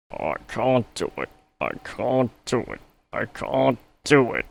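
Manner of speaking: high, effortful puffing; rhythmic engine puff, mildly strained; puffing Gordon. A narrator's reading. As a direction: strain to the top of the hill. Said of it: "puffed Gordon".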